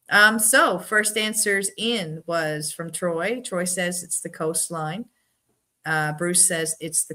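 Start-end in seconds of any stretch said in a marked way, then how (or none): none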